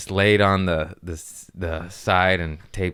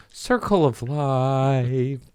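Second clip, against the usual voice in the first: mock solemn; 5 semitones higher, much slower than usual. Repeating phrase dramatically, slow.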